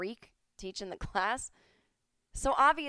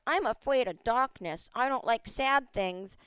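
louder, slow, whiny dimwit voice; mock scared dummy; 3 semitones higher, 6 dB louder than usual. Mocking the yoga class people as scared dummies, whiny and louder. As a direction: play the frightened simpleton, hammed up. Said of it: "it's not 'cause they're like".

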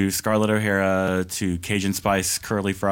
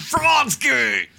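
high-pitched hammed-up yell; mock rant shout; 14 semitones higher than usual. Co-host echoing the conspiracy song, mock shouting. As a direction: belt it out like a ranting host.

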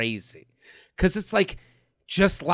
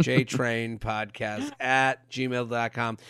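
low, slow, spelled-out announcement; slow repeat; 10 semitones lower, 11 dB quieter, slower than usual. Real, not acted. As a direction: dictate the address slowly, word by word. Repeats email address slowly for listeners.

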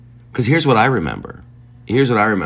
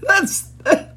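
much higher voice, breaking with laughter; words squeezed out through helpless giggles; laughing fit. Real, not acted. Host cracking up at Bruce Lee writing about the WHO, laughing through it. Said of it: "I'm choking on my own laughter".

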